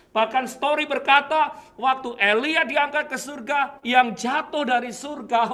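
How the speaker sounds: loud, high, driving sermon voice; fired-up preacher pitch; full-force preaching. Acted. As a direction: preach it to the back row.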